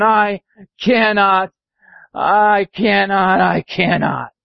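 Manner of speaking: slow, drawn-out tired sighing; slow weary lament; weary old engine. Acted. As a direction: drag the words out, worn out. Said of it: "rusty old engine sighed".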